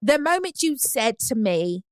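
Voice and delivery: put-on slow, affected London accent; Dorit. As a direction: put on a London accent, pausing between phrases. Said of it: "You sound like Dorit".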